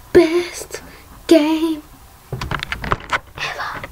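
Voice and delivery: breathless whispered repeat of the claim. Shown as a demonstration. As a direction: whisper it like a secret.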